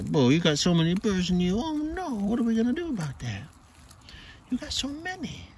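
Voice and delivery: very high cooing, sing-song. Real, not acted. Owner coos high at his cat covered in burrs.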